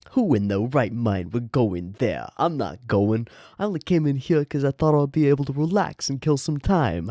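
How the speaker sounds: complaining in a put-on New York accent. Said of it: "like, New York".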